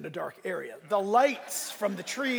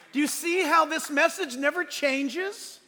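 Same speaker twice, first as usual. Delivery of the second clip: high, slow, wondering question; marvelling; 7 semitones higher, 4 dB louder than usual. Real, not acted. Pastor marvelling to the congregation, slow and high. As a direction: marvel at it with them.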